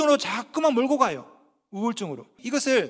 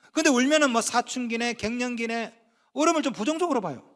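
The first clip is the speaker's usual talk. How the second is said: ordinary delivery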